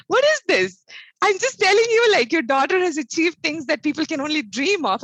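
protest, stunned that nobody cares; shocked disbelief. Retold or imitated. Daughter protests to her mother, in shocked disbelief. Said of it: "she's shocked at her mom".

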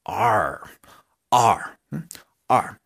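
ARE drawn out for a secret crush; posh flirty are. Shown as a demonstration. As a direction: purr ARE as if your crush stood in front of you. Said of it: "pronunciarlo bien gomelito y como si lo tuvieran a su amor platónico".

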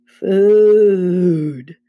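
long, loud moan of "food". A narrator's reading. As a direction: moan the word out slowly. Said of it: "Then Grover moaned".

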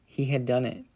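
ordinary delivery

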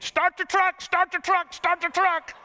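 high, loud urgent yelling; frantic holler. Retold or imitated. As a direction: scream it while running for the truck. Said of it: "he's hollering at Uncle Pete".

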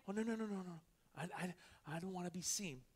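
quiet, shrinking mock protest; shy away from being seen, softly; mock timid objection. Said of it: "some people saying".

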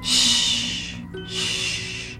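hushing. Acted. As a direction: lull them to sleep. Computer lulling captain to sleep.

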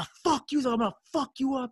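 high-pitched, rushed imitation of an opponent losing it; mock angry threat. Beaten opponent threatening the winner, mock furious.

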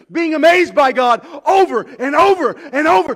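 loud and high, rising toward amazement; exultant pulpit voice; full-force preaching. Acted. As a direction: build to a joyful climax.

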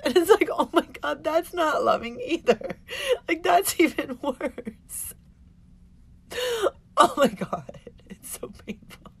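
tearful laughing, high and breaking; high, choked-up words; crying. Real, not acted. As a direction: laugh through real tears until the voice gives out. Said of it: "and I'm crying again".

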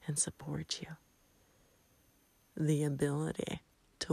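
soft, hushed bedtime voice with long pauses; sleep meditation. Real, not acted. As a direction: lull the listener gently, phrase by phrase. Guides listeners toward sleep.

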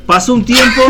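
normal talking voice

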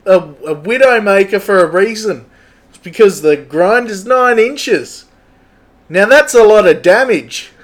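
cheery bloke bragging about his tools and the pub; an eager tradie introduces himself to the viewers, high and loud; Gavin. Acted. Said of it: "so that's our new character, Gavin".

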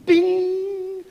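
high, ringing, held-out "bing"; imitated ping. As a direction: sing out a sudden alert chime. Imitates an alert on hearing accent.

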